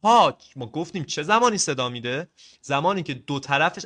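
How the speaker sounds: usual speaking voice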